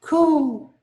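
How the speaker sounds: high dove call; dove coo. Acted. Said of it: "One was a dove".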